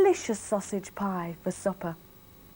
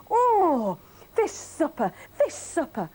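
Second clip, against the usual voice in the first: higher, fed-up wife voice; exasperated wife; 8 semitones higher than usual. Acted. Wife Millie, fed up with fish, in a higher voice. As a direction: sigh it out like a weary wife.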